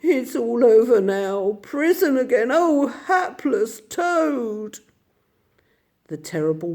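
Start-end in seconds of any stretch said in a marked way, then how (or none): 0.0-4.8 high, slow, self-pitying lament; louder woeful wail, not murmured; Toad lamenting. A narrator's reading. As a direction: wallow in it like a doomed toad.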